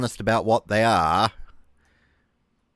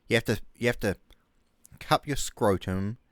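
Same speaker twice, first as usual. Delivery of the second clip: ordinary delivery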